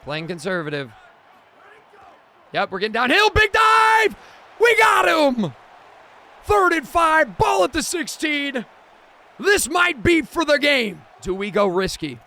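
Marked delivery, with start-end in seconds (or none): 2.5-11.0 high, loud hype yelling at the play; cheering at the screen; excited shout. Real, not acted. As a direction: yell it like a game-winning stop. Big defensive play in football game.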